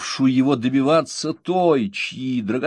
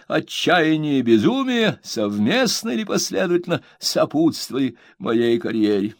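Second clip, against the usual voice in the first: pompous reading; 8 semitones higher than usual. A narrator's reading. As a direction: savour every grand word. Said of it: "делал это с таким смаком".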